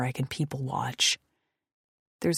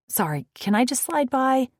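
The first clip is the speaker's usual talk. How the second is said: higher, brighter polite ask; polite request; 10 semitones higher, 6 dB louder than usual. Acted. Woman asking biker to let her pass, polite and higher. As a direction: ask to squeeze past, politely.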